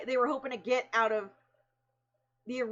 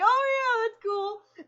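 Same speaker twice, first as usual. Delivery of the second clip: much higher, strained fake breeziness; mock nonchalant reply; forced casual; 10 semitones higher, 4 dB louder, slower than usual. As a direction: pretend it doesn't sting, pitched way up. Faking calm after bad news.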